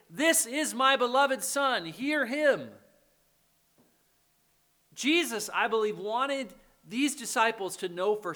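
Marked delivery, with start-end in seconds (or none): 0.1-2.7 slow, louder proclamation, pitched up; lightly acted voice from heaven; God. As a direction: proclaim it firmly, word by word. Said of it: "audible voice of God saying".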